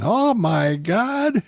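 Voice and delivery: drawn-out, high mock shout; retold shout. Imitating a diner reacting to election returns, mock shouting. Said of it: "some guy shouting".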